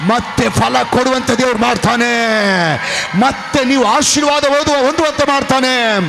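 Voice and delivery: loud, high, rising sermon shout; full-force preaching. Acted. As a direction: preach it to the rafters.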